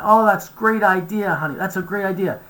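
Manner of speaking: high, sugary fake praise; humoring a child. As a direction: pat them on the head with praise. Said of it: "they'll support you at the front".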